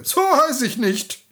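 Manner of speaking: high little goblin, insisting; beharrliches Männlein. A narrator's reading. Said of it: "Das Männlein aber blieb dabei". (hohe, beharrliche Männchenstimme)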